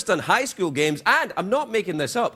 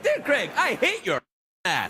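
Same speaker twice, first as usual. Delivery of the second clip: higher, mock-cheerful letter voice; cheerful hate mail; 5 semitones higher than usual. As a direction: read the insult like a sweet, cheery note. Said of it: "the cheerful hate mail".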